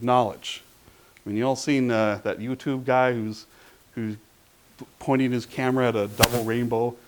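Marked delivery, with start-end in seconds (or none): none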